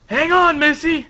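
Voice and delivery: loud, high anime hero shout; urgent acted yell; calling out. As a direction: holler to a friend in trouble. Luffy calls to drowning friend.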